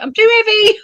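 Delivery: very high mock protest; mock wailing protest. Wife refusing husband's offer to catch her, mock wailing. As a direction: squeal it as a comic excuse.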